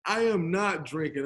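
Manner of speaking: normal talking voice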